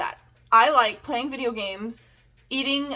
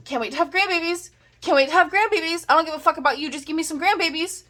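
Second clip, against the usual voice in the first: mock pushy parent, higher and quicker; 6 semitones higher, 3 dB louder, faster than usual. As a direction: lampoon a grandbaby-hungry parent. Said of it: "a lot of parents are like".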